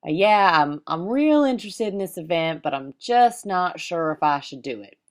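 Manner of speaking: imitated slow-talking Southern gentleman; Southern drawl. Southern client unsure about a speaking event, Southern drawl. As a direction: put on an unhurried Southern drawl, hesitant. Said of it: "he talked in that nice Southern drawl".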